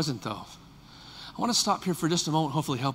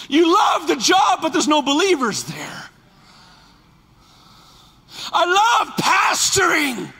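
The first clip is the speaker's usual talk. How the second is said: loud, high, impassioned preaching; full-force preaching; 12 semitones higher, 6 dB louder than usual. Acted. Pastor preaching to his church at full force. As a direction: build the sermon to a cry.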